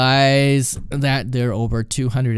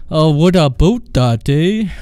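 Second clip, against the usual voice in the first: louder, drawn-out mock Canadian accent; imitated Canadian accent; 4 semitones higher, 6 dB louder, slower than usual. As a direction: put on a hammy Canadian "eh". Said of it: "trying to imitate the Canadian accent".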